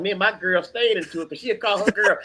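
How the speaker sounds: usual speaking voice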